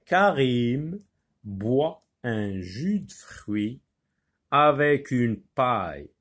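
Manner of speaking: slow, syllable by syllable; slow articulated reading. Shown as a demonstration. Teacher modelling careful reading for pupils, slow and articulated. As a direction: stretch each word out so the class can follow. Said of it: "Il faut lire avec expression, avec articulation".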